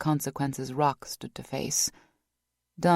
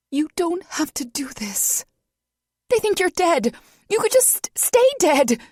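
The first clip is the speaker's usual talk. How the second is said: much higher and louder, breathless begging; desperate, out of breath; panting, high-pitched plea; 7 dB louder than usual. Acted. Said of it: "She was panting, her voice unusually high".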